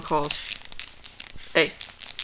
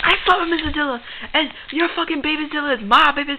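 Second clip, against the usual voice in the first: blurted confession; 7 semitones higher than usual. Acted. Says it out loud after whisper.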